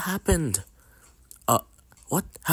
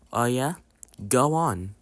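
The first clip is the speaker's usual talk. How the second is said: ordinary talk, nothing marked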